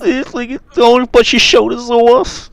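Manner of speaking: very high mock-rapper voice. Imitating the rapper for co-hosts, high-pitched put-on voice. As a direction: put on a squeaky impression of a rapper. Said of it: "what [name] sounds like".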